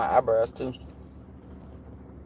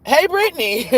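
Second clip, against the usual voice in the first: put-on pestering kid; 12 semitones higher, 4 dB louder than usual. Stepkid interrupting the movie, imitated as a high pestering call. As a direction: imitate a little kid calling for attention. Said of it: "she came right back and said".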